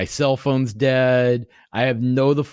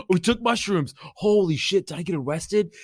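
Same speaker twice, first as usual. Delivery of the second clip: higher-pitched, mock-alarmed realisation; retold freak-out; 6 semitones higher than usual. Man retelling waking in a French jail cell, mildly freaked out. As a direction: replay a dawning panic, pitched up. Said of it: "I'm sitting there freaking out".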